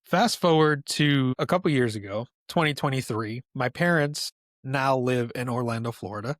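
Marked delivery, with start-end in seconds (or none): none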